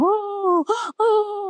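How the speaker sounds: high-pitched imitated wail of "oh"; mock wailing. Teacher imitating funeral mourners for the children, mock wailing. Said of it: "they were wailing and weeping and".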